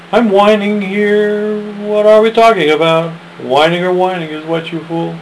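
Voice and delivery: lightly voiced whiny child; whiny questioner. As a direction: whine it like a confused kid. Second voice in a dialogue.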